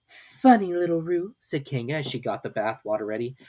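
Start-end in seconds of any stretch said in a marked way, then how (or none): 0.1-1.3 fond humouring mother. A narrator's reading. Kanga humouring Piglet.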